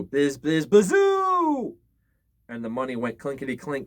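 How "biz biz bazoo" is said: high, loud wind howl rising on bazoo; eerie wind whoosh. A narrator's reading. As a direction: moan it like wind in the cracks. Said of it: "the wind blew".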